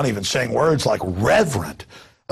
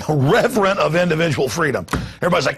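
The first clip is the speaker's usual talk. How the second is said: usual speaking voice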